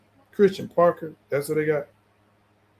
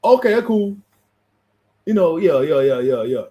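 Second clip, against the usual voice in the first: Lamar Jackson impression; 3 semitones higher than usual. Host mimics quarterback reacting to a play install, light impression. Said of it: "Let me put in my Lamar Jackson voice".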